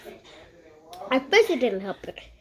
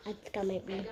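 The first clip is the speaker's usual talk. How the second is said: soft, timid little voice; a small, quiet admission; 9 dB quieter than usual. A narrator's reading. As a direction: say it small and unsure. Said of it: "Ivy said in a small voice".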